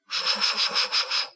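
hushed whispering babble; whispered muttering. Acted. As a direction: whisper among yourselves. Whispered conferring above.

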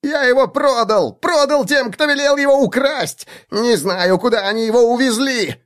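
high, desperate squeal; shrill frightened halfling voice; panicked halfling. A narrator's reading. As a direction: babble out the confession.

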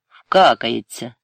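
slow, loud, firm dismissal. A narrator's reading. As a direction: say it hard and decisively. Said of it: "твердо мовив Сергій".

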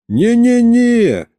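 high, drawn-out protest; emphatic denial. A narrator's reading. Narrator calms the horrified king, high drawn-out denial. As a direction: wave the idea away in a high voice.

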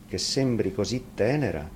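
plain reading voice

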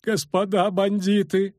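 put-on high, slow, whiny old lady pleading; whining matron. A narrator's reading. Matron whining at the bandits, high and slow. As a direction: whine it like a frightened chaperone. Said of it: "заныла Матрона".

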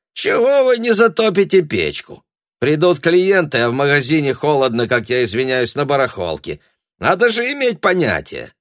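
high, loud scolding; shouting at a servant. A narrator's reading. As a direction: berate the hired help. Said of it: "а тут взял и накричал".

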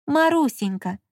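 happy voice. A narrator's reading. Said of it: "проговорил он счастливым голосом".

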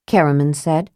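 ordinary delivery, nothing marked

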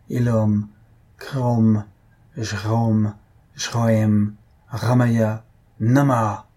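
raucous, guttural magic formula; throaty chant, one word at a time; hoarse guttural incantation. A narrator's reading. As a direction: intone each word apart, from the throat. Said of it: "d'une voix rauque et gutturale".

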